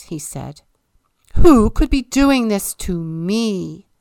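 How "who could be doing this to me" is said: loud, wailing complaint; sulking king crying out over his ruined face; anguished outcry. A narrator's reading. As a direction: wail it to the whole room.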